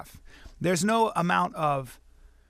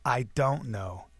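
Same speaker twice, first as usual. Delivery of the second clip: low, slow, deliberate dark dad; menacing father; 6 semitones lower, 4 dB quieter, much slower than usual. Retold or imitated. Father answering son about mountain lion, low menace. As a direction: imitate an ominous man, slowly and quietly.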